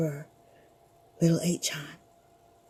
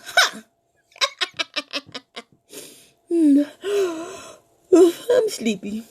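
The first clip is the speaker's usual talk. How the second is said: put-on sleepy boy; 6 semitones higher, slower than usual. Boy laughs then feels sleepy.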